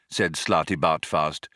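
ordinary delivery

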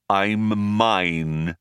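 thin metallic robot voice. A narrator's reading. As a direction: speak like a thin, tinny robot. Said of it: "came a thin metallic voice".

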